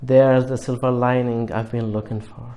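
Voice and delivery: ordinary delivery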